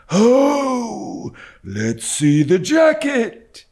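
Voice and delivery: put-on old man's high, slow wailing cry. A narrator's reading. Drunken old dealer to boy at his shop, wailing cry. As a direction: wail it out like a crazed old dealer. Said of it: "cried the old man".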